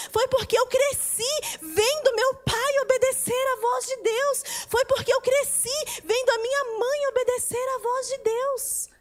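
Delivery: high, driving testimony with punched key words; impassioned preaching. Acted. Daughter testifying to parents' obedience, impassioned. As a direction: preach it with mounting fervour.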